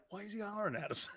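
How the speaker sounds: very soft, quick, bewildered mutter; hushed aside. Retold or imitated. Player to Stubbs while coach hollers, hushed aside. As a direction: imitate whispering to the player beside you.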